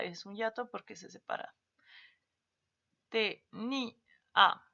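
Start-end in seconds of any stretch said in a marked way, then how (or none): 3.1-4.6 "Te, ni, a" syllable by syllable, last one punched loud; split into syllables. Shown as a demonstration. Teacher breaking the word into syllables for the class, last syllable loud. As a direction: spell out "Te, ni, a" with a hard final "a". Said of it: "Tenía y tenía".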